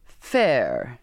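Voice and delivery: low, scornful echo; thick with derision. A narrator's reading. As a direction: throw the word back with contempt. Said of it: "his voice thick with derision".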